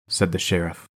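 plain reading voice

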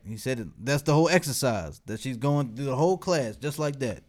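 ordinary delivery

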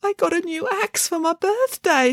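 put-on proud little boy explaining; high childish explanation; innocent small boy. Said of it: "said the boy".

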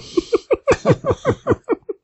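high giggling; a run of small high giggles; laughter. Real, not acted. Host amused by Boom Boom's reply, giggling. Laughs at own retold joke.

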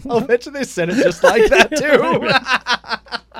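high, loud, laughing through the words; real laughing. Real, not acted. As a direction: crack up while playing along with the joke. Cracking up at co-host's impression.

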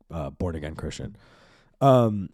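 ordinary talk

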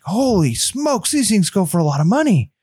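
mock astonished gasp, slowed and high; hammed-up shock at the prices; imitating a stunned seller reading eBay; amazed.

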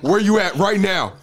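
cracking up while reading the message; laughing quote. Retold or imitated. Reading [name]'s text aloud, laughing hard. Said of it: "He said".

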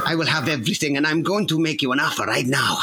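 hammed-up, muddled Greek accent; bad Greek accent. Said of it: "the worst Greek accent I've ever heard".

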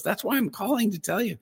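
bubbling over with good news; excited woman. Retold or imitated.

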